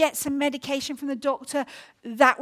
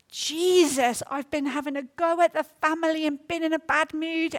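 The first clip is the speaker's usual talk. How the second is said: slow, louder pleading prayer; retold prayer cry; 3 semitones higher, 3 dB louder, slower than usual. Runner praying aloud to Jesus on morning streets, slow pleading. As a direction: call out to Jesus slowly and with feeling. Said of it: "I have been shouting out".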